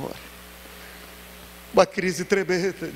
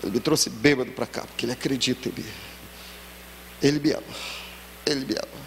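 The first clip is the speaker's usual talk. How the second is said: tender, moved confession; 5 semitones lower, 6 dB quieter than usual. Retold or imitated. A drunk Marcelo says softly to the pastor that the preacher loves him. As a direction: lower and softer, almost choked. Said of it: "Marcelo olhou pra mim e disse".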